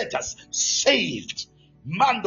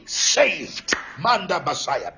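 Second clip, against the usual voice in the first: ordinary delivery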